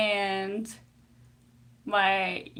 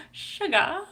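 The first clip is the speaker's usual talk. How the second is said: put-on high, playful British accent, r dropped; 7 semitones higher than usual. Streamer amused by own accent, high mock British. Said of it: "I gotta stop doing accents".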